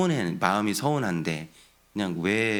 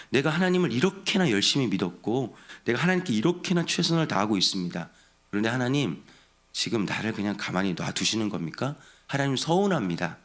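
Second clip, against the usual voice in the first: ordinary talk, nothing marked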